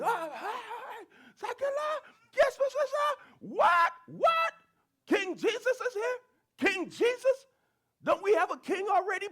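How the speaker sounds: high, startled questioning voices; astonished crowd. Acted. Townspeople hearing of King Jesus, high astonished questions. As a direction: play the confused crowd, shocked.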